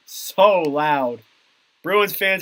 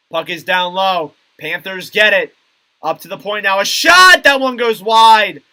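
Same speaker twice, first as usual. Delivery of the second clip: animated call, leaping higher and louder on each shot; live play-by-play; 4 semitones higher, 7 dB louder than usual. Real, not acted. Commentator calling play up to Coyle's shot, live play-by-play. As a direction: follow the puck, erupt on every shot.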